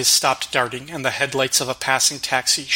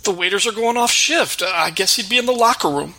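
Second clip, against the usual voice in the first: much higher, nervous babbling cook; stammering fat cook; 7 semitones higher than usual. Acted. Frightened cook confessing tax cheating to the Batman, high nervous stammer. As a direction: blurt out excuses, caught red-handed. Cook's voice.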